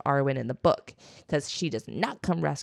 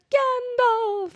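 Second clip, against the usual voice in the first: very high, joyful cry of a name; happy Frodo; 4 dB louder than usual. Retold or imitated. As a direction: greet an old friend with delight. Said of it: "he's so happy to see him".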